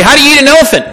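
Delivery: high, loud teasing riddle; playful teacher springing a riddle; teasing question. Retold or imitated. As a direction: pose it to freshmen with a grin. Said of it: "I'd say".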